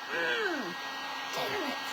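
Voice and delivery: high, hushed mock cursing; retold muted anger. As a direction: mimic cursing quietly to yourself. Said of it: "I'm mad".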